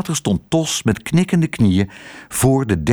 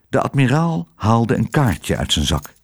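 usual speaking voice

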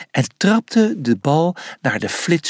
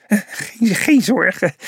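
sheepish; 7 semitones higher than usual. A narrator's reading. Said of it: "zei de antiloop schaapachtig".

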